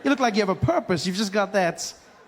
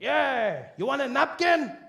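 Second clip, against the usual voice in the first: put-on higher Mexican-accented offer; 6 semitones higher, slower than usual. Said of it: "He's like".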